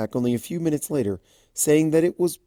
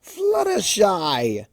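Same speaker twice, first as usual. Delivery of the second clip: Discord calling out; 8 semitones higher, much slower than usual. Acted. Discord greets her warmly.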